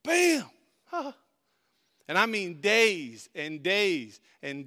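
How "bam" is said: sharp high shouted bam; mock headbutt bam. Preacher acting the bully's headbutt, sharp bam. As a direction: smack out the hit like a punch. Headbutt sound in bus story.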